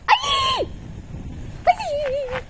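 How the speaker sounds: high sharp frightened cry; scared shriek. Real, not acted. Scared on the downhill.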